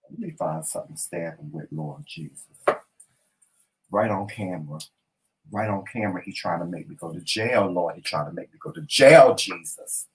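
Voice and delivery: husband grumbling to Jesus about his wife, low mutter; muttered prayerful grumble. Real, not acted. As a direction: mutter it under your breath to the Lord. Muttering complaint to the Lord.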